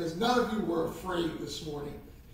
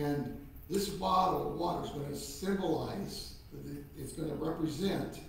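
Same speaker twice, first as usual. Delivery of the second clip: ordinary delivery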